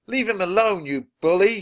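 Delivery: high, loud protest; indignant high-pitched cry. A narrator's reading. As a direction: stand up angrily to a bully. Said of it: "she cried".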